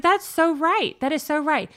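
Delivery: loud, high eager agreement; excited. Retold or imitated. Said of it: "I was like".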